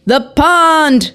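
high-pitched cry, held back in volume; mild yell. A narrator's reading. Bystander crying out at the helicopter crash, restrained yell. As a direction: call out high without full force. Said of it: "someone yelled".

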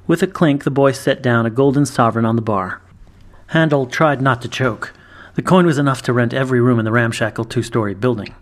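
ordinary delivery

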